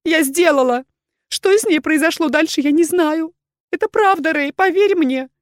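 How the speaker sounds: high, loud, pleading woman's voice; overwrought, high-pitched justification; desperate confession. A narrator's reading. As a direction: pour out excuses, begging to be believed. Accused woman's frantic confession.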